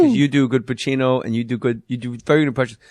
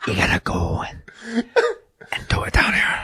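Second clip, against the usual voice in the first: low, whispered Walken; deep whispered Walken demo; 7 semitones lower than usual. Shown as a demonstration. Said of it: "go down into the whisper".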